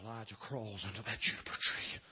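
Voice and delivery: low, very soft narration; hushed storytelling. A narrator's reading. Preacher telling of Elijah under juniper tree, hushed. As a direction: tell it in a hush.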